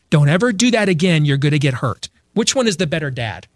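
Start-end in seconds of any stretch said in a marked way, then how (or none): none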